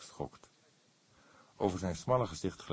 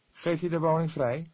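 put-on higher, louder young Fledder; lightly voiced younger man asking; young detective voice; 11 semitones higher, 5 dB louder than usual. A narrator's reading. Fledder asks the old cook, higher and louder.

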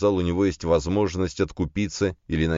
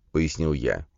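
usual speaking voice

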